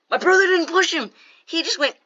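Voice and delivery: high, loud mock explanation; exasperated protest. Kid explaining to the teacher for hours, exasperated protest. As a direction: push the voice up high, over-explaining.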